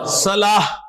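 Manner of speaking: صلاح closed on H; final H sound. Shown as a demonstration. Teacher answering his own question, صلاح with final H. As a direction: end صلاح with H and no T. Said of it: "صلاح پڑھیں گے نا کہ صلاۃ".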